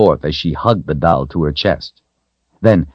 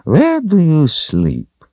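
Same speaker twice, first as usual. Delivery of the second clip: slow, high-lilting wizard's question; inquiring wizard; 12 semitones higher, slower than usual. A narrator's reading. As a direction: ask a child with slow, drawn-out curiosity. Wizard questions the little girl.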